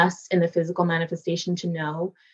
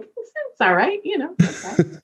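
much higher, offhand reassurance; dad reassuring; 10 semitones higher than usual. Retold or imitated. Dad shrugging off worry, offhand. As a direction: brush it off like her dad would. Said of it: "where he'd be like".

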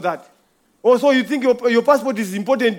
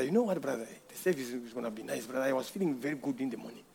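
much softer, lower mocking chatter; mock casual churchgoer; 10 semitones lower, 16 dB quieter than usual. Mocking a brother chatting from his car on the way to church. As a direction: mimic a smug brother chatting idly.

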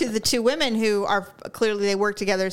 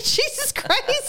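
high, drawn-out mock exclamation; retold exasperation; much slower than usual. Reacting to being spat on, high mock exasperation. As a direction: exclaim it slow and high through laughter. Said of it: "I was like".

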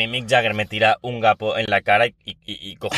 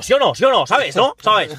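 mocking insistent talker; 11 semitones higher, 4 dB louder than usual.

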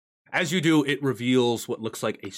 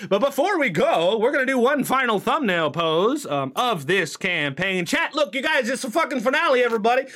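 higher, louder streamer hype; hyped announcement; 11 semitones higher, 4 dB louder than usual. Real, not acted. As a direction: pump up the chat. Hyping chat for the finale.

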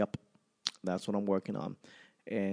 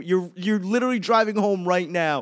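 ordinary talk

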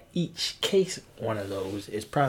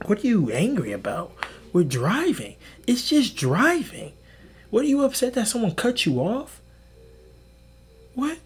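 baffled; 11 semitones higher, 4 dB louder than usual. Real, not acted. Mocking road rage.

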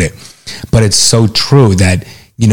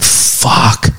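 whispered curse; 2 semitones higher than usual. Retold or imitated. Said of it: "I'm like".

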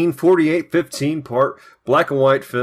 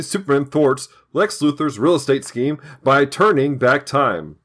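normal talking voice

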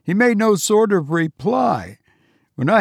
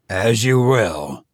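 put-on lower, slow resigned old father; gloomy; 7 semitones lower, slower than usual. A narrator's reading. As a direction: give in, weary and gloomy. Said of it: "he sank into great gloom".

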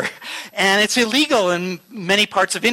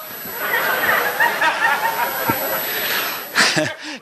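breathy laugh; 9 semitones higher than usual. Real, not acted. Laughs at own sarcastic version.

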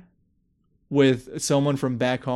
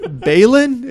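higher, louder, through laughter; laughing guess; 10 semitones higher, 7 dB louder than usual. Retold or imitated. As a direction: guess the name while cracking up.

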